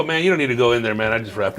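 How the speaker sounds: understated overconfident actor; a hint of cocky actor. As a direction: brag without trying too hard. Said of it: "actor ... go".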